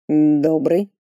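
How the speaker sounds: confused, trailing rise; bewildered. Acted. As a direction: caught off guard, unsure reply. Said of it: "растерянно отозвался тот". (растерянно)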